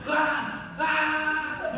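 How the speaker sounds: mock scream. Cohost imitating a friend screaming at a spider, mock scream. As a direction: yelp in pretend terror.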